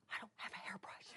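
hushed, voiceless aside; tiny helpless whisper; whispered objection. Retold or imitated. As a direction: whisper it as a private thought. Acted thought answering God.

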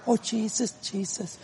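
hushed, fading "Oh, Jesus"; quiet prayer. Retold or imitated. Preacher shows how wife used to pray, quiet imitation. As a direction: pray softly, almost under the breath. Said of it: "She used to pray like this".

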